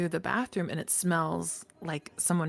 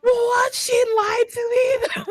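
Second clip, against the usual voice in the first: very high, loud betrayed wail; hammed-up sobbing shout of disbelief; mock crying; 10 dB louder, slower than usual. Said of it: "I'm crying so".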